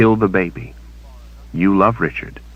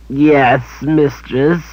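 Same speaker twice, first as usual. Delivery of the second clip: higher whined creature answer; whining creature; 9 semitones higher, much slower than usual. A narrator's reading. Creature servant admitting guilt to his mistress, whining. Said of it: "Samuel whined".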